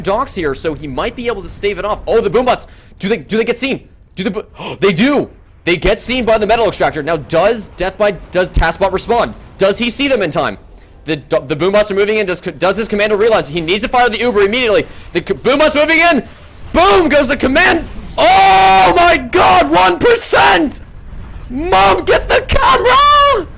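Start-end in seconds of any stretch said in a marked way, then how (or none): 2.1-14.8 fast, higher, louder caster hype; rising excitement. Real, not acted. Caster to viewers as the snipe unfolds, climbing excitement. 15.1-23.5 shrieking, far higher and much louder; ecstatic screaming. Real, not acted. Caster to viewers as the commander survives, ecstatic screaming.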